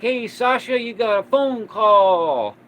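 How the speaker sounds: high, loud call across the store; a coworker shouting the news over. Retold or imitated. Coworker calls him to phone.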